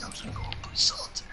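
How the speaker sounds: breathy high whisper, quick; whispered quote. Retold or imitated. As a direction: mimic him muttering under his breath. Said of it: "he's just like".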